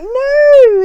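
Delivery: very high, loud exclaimed objection; mock protest. Boss refusing to kill the spider, retold as a loud protest. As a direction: cry out in exaggerated objection.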